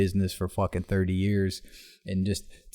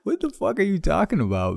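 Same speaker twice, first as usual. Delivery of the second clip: high-pitched mock bewilderment; baffled disbelief; 11 semitones higher than usual. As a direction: react incredulously, voice shooting up. Baffled reply to a crazy outburst.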